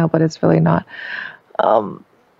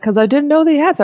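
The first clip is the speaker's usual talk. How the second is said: higher, fast sheepish excuse; imitating Scott; 7 semitones higher, faster than usual. Husband Scott excusing himself to his wife, mock sheepish. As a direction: toss it off quickly like a caught husband. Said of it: "And he's like".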